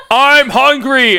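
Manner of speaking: slow, high, loud awkward yell; hammed-up hungry shout; awkward scream. A narrator's reading. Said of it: "Apocalypse screamed awkwardly again".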